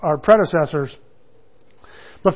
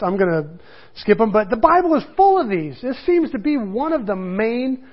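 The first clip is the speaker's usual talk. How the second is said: ordinary delivery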